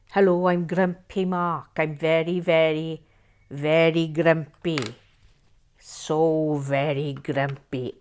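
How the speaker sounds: slow Welsh-accented grump; Grumpy Mark. Shown as a demonstration. Host auditioning a character for viewers, grumpy Welsh accent. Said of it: "could be from Wales".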